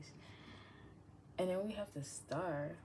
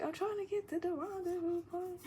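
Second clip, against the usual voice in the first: mock hurried woman; 13 semitones higher, 4 dB louder than usual. Voicing the hurrying woman.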